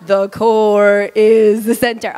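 slow, low, droning delivery; mock unenthusiastic. Shown as a demonstration. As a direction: drag it out low, with no excitement at all. Contrasting unexcited demo of audience response.

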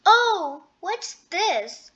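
storybook exclamation of surprise; crying out in surprise. A narrator's reading. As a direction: cry out at a sudden discovery. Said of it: "she cried".

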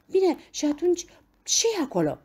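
high, loud eager question; pleading curiosity. A narrator's reading. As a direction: press insistently for the answer. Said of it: "rugându-l din priviri să-i dezvăluie misterul".

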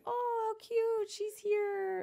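put-on high, slow baby-talk gush; drawn-out sing-song adoration; mock cooing. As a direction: coo over a cute toddler in a high voice. Retold cooing at a toddler.

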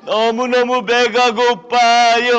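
slow, drawn-out hungry moans; starving beggar moaning. Acted. Beggar moaning at the rich man's gate. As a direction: moan like a beggar faint with hunger.

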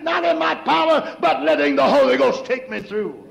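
fervent, rising preacher's cadence, half shouted; preaching at full force. Acted. Preacher to his church, whooping at full force. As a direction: preach it hard to the congregation with music behind.

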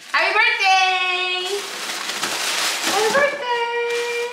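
high, drawn-out cheer; excited celebration. Real, not acted. Woman cheering on a birthday, high excited squeal.

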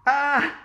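short, high, loud shriek; shouted scream. A narrator's reading. Boy sees pliers at the dentist's, screaming. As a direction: let out a sudden loud "Ah!". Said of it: "I shouted".

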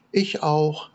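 very high peeping chick voice; baby chick. A narrator's reading. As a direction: peep it like a tiny chick. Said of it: "meldete das Küken".

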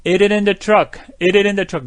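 Eat it in the truck linked, the T flapped, "the" nearly gone; reduced connected speech. Shown as a demonstration. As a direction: run Eat it in the truck together casually. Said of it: "거의 빼먹다시피 발음해요".

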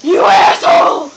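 loud furious shout; furious yell. Acted. Big brother furious at his sibling, loud yell. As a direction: explode at him.

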